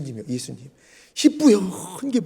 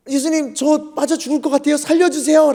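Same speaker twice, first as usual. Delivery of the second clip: desperate pleading; 6 semitones higher than usual. Retold or imitated. Sinking Peter to Jesus, pleading desperately. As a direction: beg to be saved, acting it out.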